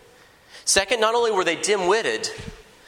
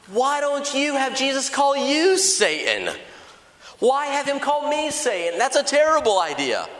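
indignant retort; 7 semitones higher than usual. Acted. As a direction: throw the idea back at your friend, affronted. [name] indignantly rejects Mark's idea.

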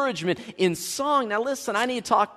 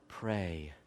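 mock pious murmur; 12 semitones lower, 15 dB quieter than usual. Preacher imitating a well-meaning brother, low and soft. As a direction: Pray dropped very low and soft. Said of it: "well-meaning brother or sister".

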